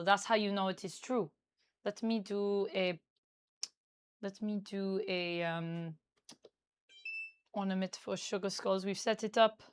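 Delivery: put-on Parisian-style French accent. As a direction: speak English as a Parisian would, with a French accent. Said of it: "but this is my French accent".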